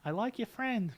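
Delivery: put-on girlfriend voice, pitched high; imitated ex-girlfriend. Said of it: "she would just say, you know".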